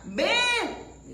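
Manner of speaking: high, drawn-out summons; acted call. Preacher acting the Lord calling Pedrito, acted call. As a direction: call him over, voice lifted high.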